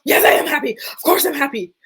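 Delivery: high, loud, over-defensive imitation; aggressive, non-convincing. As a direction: snap it back, protesting far too much. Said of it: "in the most aggressive, non-convincing way".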